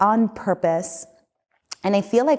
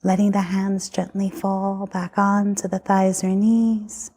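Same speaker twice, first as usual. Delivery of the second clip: guided meditation. Acted. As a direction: lead them gently through the body. Leads a guided body scan.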